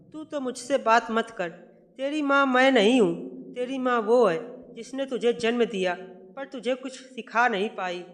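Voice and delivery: mother-in-law insulting the bride's upbringing; angry insult. Acted. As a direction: lash out at her, peaking then easing.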